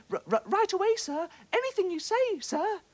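high, stuttering and scared; stammering little fish. A narrator's reading. Frightened little fish stammering to the bully octopus. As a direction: squeak it out, trembling with fear. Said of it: "bubbled the poor little fish".

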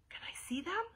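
put-on high, soft, whispery child; timid. A narrator's reading. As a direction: ask shyly, barely daring to. Nervous first grader asks shyly.

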